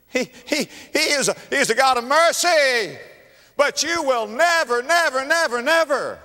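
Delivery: very high, loud, impassioned proclamation; hammering repeated words at top pitch; preaching at full force. Real, not acted. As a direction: cry it out to the congregation.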